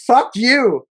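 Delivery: high-pitched quoted yell, only half shouted; retold yell. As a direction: act out his angry shout, held back a little. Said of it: "He's yelling".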